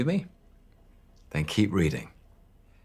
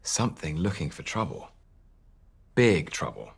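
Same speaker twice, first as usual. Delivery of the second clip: ordinary delivery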